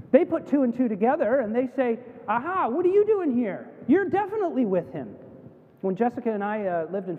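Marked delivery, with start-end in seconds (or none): 2.3-5.2 high-pitched "gotcha" accusation; acted triumphant accusation; accusing bystander. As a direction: catch someone out, pitched up and pointed.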